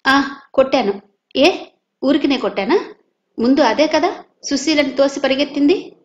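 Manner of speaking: defiant retort. Acted. Madhu answering his grandmother, defiant and loud. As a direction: snap back in self-defence. Boy defending himself to grandmother.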